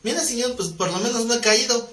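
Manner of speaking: lightly voiced complacent churchgoer. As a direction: imitate a believer content with doing little. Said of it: "así andan muchos cristianos".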